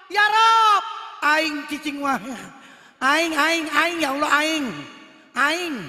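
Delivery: put-on loud Sundanese-accented prayer repeating aing. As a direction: shout it like a Sundanese. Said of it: "Sunda, boleh".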